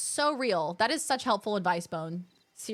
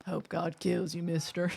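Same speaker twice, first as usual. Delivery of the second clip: a lower, rough villain voice; low gruff cowboy; 8 semitones lower, 3 dB quieter than usual. Pretend. Mocking the ranch hands with a lower threat. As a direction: growl it like a mean cowboy. Mock menacing cowboy line.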